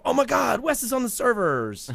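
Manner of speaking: high, loud mock exclamation; imitating someone's excited shriek; excited gasp. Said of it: "you know".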